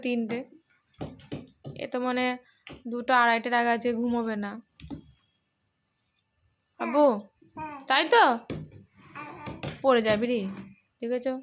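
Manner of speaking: ordinary delivery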